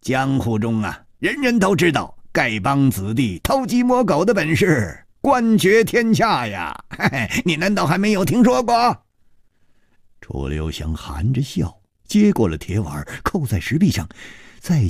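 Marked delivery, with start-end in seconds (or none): 0.0-9.0 high, stretched-out bragging with a chuckle; gleeful, higher-pitched character voice; amused boasting. A narrator's reading. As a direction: brag playfully, drawing out the punchline. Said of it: "歹毒行笑着说".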